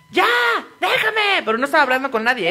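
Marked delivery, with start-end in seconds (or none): 0.1-1.4 high mock yell; high-pitched imitated shout; imitating a child's yell. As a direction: shriek it like the boy at the window. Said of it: "se salía por la ventana y gritaba".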